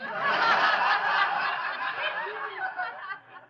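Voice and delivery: loud high burst of laughter; hearty guffaw; big laugh. Acted. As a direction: roar with laughter at the joke. Fibber laughs at the joke.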